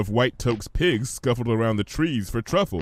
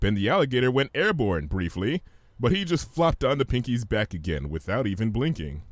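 ordinary delivery

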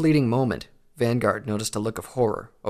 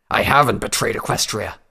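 snarled; 6 dB louder than usual. A narrator's reading. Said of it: "Stoneshot snarled".

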